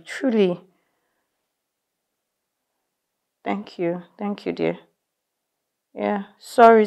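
hushed, calming her husband on the phone; soft and quiet. Acted.

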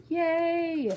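high, loud little cheer; excited yay. Real, not acted. Opening Linda's box on camera, a happy high cheer.

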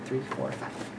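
whispered counting. Real, not acted. Counting toes to toddler.

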